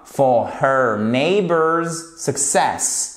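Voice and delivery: slow, careful English; slow model phrase. Shown as a demonstration. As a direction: say it slowly so they hear each word. (lecture lente et soignée)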